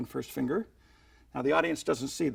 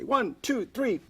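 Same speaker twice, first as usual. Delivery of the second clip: showman's count; 9 semitones higher, slower than usual. Shown as a demonstration. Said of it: "You say".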